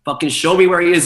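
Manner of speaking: heated re-enacted order; urgent demand. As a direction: demand proof, voice pushed up. Panicked demand to see missing friend.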